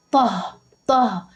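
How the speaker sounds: last syllable "طه" alone, ending in an audible h; final ha sound. Shown as a demonstration. Teacher demonstrating how the ending sounds when paused, "طه" with final h. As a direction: isolate "طه" and let the h be heard. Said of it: "بنطقها هاء".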